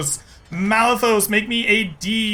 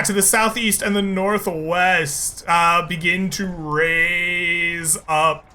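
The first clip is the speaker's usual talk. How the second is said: normal talking voice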